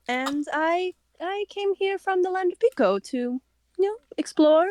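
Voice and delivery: a hint of a British accent, polite and cheerful; British-sounding Catalina. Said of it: "she's gonna sound British".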